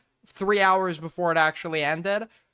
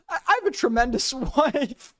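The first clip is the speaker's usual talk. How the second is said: higher, giggling parody of the line; laughing mock quote; 6 semitones higher than usual. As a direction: mimic the slip while cracking up. Streamer mocks the slip.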